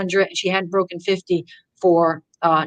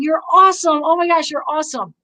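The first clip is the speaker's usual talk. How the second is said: cheering praise; 7 semitones higher than usual. Retold or imitated. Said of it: "Gary kept saying".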